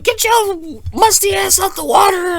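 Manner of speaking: very high, loud put-on mom shout; yelling mom. Mom calling kids out of the pool, high-pitched yelling. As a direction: holler at the kids like an angry mom. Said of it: "the mom's yelling".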